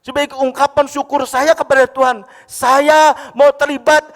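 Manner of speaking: loud, high preaching cry, slowing hard at the end; fervent loud testimony; preaching at full force. Real, not acted. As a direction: build to a shouting climax, drawing the last line out. Preacher's climax on serving God.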